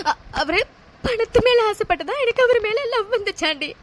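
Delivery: ordinary talk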